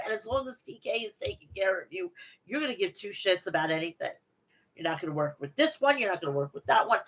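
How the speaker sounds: usual speaking voice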